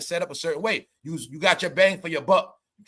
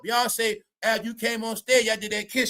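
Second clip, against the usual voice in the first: normal talking voice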